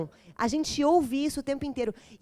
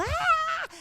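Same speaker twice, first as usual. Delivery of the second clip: put-on childish angry yell.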